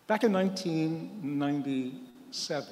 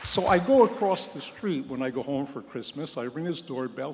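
ordinary talk, nothing marked

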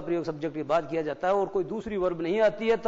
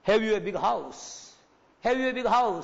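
"Have you a big house?" pitched up as a model question; 5 semitones higher, 3 dB louder, much slower than usual. Shown as a demonstration. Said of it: "प्रश्न पूछने जैसी मुख मुद्रा बनाओ".

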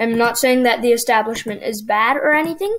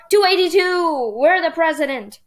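excited cheer; 5 semitones higher than usual. Real, not acted. Player cheering as the tally passes the target, excited. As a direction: celebrate the win. Passes 270 electoral votes.

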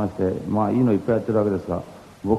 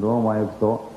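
normal talking voice

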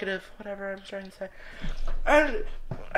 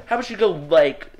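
usual speaking voice

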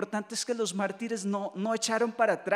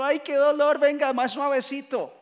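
higher, louder mock plea in pain; mock complaining; 5 semitones higher, 5 dB louder than usual. Imagined martyr pleading with those stoning him, mock-pained. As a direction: act out a martyr begging for gentler treatment. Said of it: "él no está diciendo".

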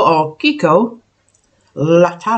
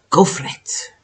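whisper. Real, not acted. Urging herself to try it.

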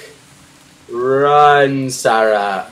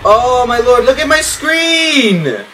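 panicked exclaim; 9 semitones higher, 4 dB louder, slower than usual. Real, not acted. Panicking at scary game screen.

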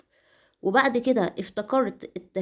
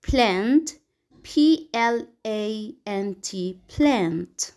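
measured spelling-bee answer, closing on a falling tone; word, spelling, word; much slower than usual. Shown as a demonstration. Pupil answering the examiner in a spelling test, slow letter-by-letter spelling. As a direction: say it, spell it slowly letter by letter, say it again. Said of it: "spell it and say it again".

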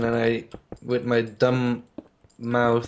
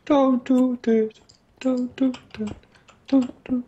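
squeaky cartoon imitation, pitched up high; South Park impression; 10 semitones higher than usual. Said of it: "That's also from South Park".

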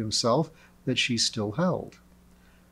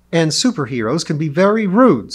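loud, higher-pitched rebuke; indignant retort; 5 semitones higher, 9 dB louder than usual. A narrator's reading. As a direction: cut in with an indignant comeback. Lee retorts to rude superhero.

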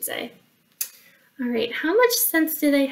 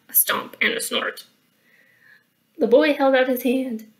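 plain reading voice

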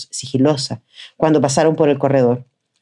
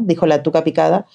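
plain reading voice

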